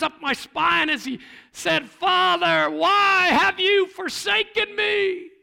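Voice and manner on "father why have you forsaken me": anguished cry. Acted. Jesus cries out on cross.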